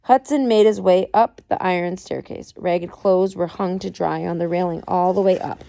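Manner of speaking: ordinary delivery, nothing marked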